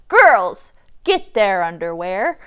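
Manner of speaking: loud call to her crew. A narrator's reading. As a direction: holler the order. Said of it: "Stinky Annie called to her crew".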